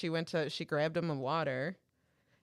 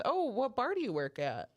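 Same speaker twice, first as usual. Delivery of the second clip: higher-pitched fake interest; smart-ass question; 7 semitones higher than usual. Friend questions self-proclaimed bartender, smart-ass. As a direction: ask it with mock innocence. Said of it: "in her smart ass way".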